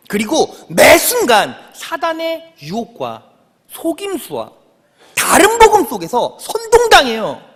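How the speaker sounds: loud, high fervent sermon build; preaching at full force. Acted. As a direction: drive the point home from the pulpit.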